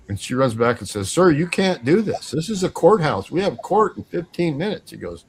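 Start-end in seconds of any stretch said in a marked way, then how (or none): 1.1-4.9 higher, insistent objection; lightly acted flustered official; retold protest. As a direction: protest urgently, pitched up. Courthouse lady protests the closure notice.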